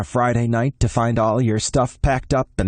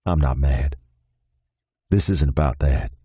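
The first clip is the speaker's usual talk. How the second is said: lower, even Texan man's voice; Noel, calm Texan boyfriend; 6 semitones lower than usual. Acted. Noel telling Ben to go back to Austin, resigned and low. Noel's voice.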